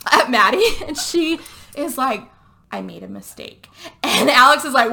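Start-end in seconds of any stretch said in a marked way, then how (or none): 2.7-3.7 small, soft, low admission; hushed confession. Retold or imitated. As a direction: confess it quietly, acting the guilty friend. Acted confession in retold film.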